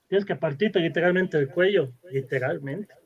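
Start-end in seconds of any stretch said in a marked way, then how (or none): none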